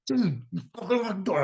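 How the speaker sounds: high, mock furious accusation; livid. Confronting roommate Jeff at the door, high mock fury. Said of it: "just livid".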